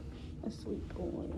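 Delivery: whispering. Real, not acted. Whispering to the cat.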